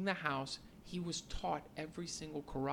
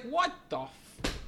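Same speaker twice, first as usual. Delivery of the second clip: stunned Daniel; 9 semitones higher, slower than usual. Retold or imitated. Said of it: "And he's like".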